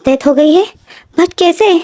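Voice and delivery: high, tearful disbelief; crying. A narrator's reading. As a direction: break down asking how. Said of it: "रोने लगता है और बोलता है".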